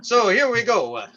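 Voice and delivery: cheerful mock farmer emcee; folksy farm-show host. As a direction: play a jolly hayseed comedian with corny chuckles. Fun Farm Hour host character.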